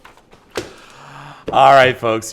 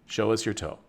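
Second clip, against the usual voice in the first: usual speaking voice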